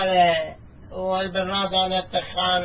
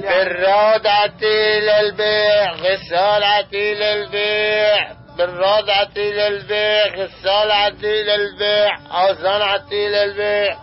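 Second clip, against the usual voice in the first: slow, chanted hawker's cry; vendor's call; 3 semitones higher, slower than usual. Acted. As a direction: sing out the wares, drawing out للبيع. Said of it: "لك اخلع صوتي هيك". (نداء بائع خردة ممدود)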